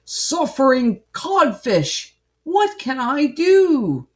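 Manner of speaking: loud, high, slow exclamation; hammed-up storybook fuming. A narrator's reading. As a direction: wail it out in exasperation.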